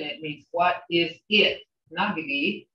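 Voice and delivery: ordinary delivery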